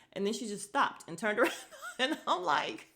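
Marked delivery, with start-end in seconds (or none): 1.2-2.9 high, giggly storytelling; laughing. Real, not acted. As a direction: laugh your way through the words. Laughs recalling the host.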